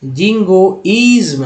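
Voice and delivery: जिंगोइज्म with a clear "izm" ending; slow model word. Shown as a demonstration. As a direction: say जिंगोइज्म slowly for learners. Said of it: "आईएसएम को इज्म बोलते हैं".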